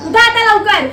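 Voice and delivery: loud, sharp command to get up; angry order. Acted. Teacher barks at students to hurry to practice. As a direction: hurry the lazy students up, loudly.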